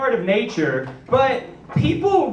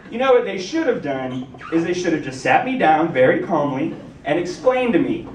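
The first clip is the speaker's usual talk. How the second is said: ordinary talk, nothing marked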